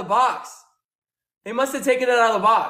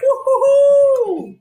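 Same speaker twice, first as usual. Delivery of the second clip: high, loud, drawn-out woo-hoo; victory whoop; 6 dB louder, much slower than usual. Real, not acted. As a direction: whoop it out in triumph. Celebrating the win.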